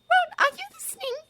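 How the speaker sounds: put-on high, loud girl's voice; Hermione voice.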